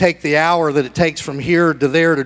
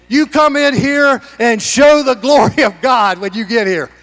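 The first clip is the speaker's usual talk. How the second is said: ordinary delivery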